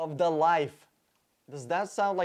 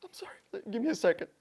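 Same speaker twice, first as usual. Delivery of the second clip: mock composing self; 5 semitones higher, much faster than usual. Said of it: "I get a little emotional".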